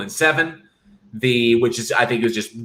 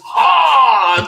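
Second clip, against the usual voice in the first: loud attack shout; 9 semitones higher, 6 dB louder than usual. Acted. Acting out Jason's attack.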